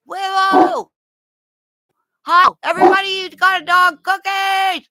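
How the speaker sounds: high, loud, excited calling voice; voiced brother on phone. Owner voicing brother calling the dog, excited high shout. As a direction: call out cheerfully to the dog. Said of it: "maybe from his brother going".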